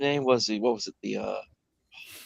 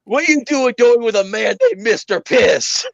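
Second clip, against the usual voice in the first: incredulous character; 8 semitones higher, 4 dB louder than usual. Retold or imitated. Acted character quote.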